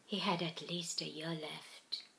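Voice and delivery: hushed whisper. A narrator's reading. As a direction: barely breathe it out. Stunned reply, whispered.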